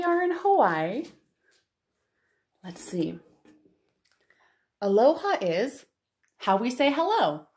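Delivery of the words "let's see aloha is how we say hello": ordinary talk, nothing marked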